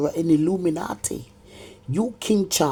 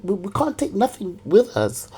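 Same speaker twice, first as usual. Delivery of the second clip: ordinary delivery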